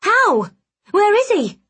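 high, loud, frantic questioning; urgent demand. A narrator's reading. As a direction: demand answers at once, voice shooting up. Henrietta frantically demands where Rook is.

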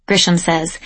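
ordinary delivery

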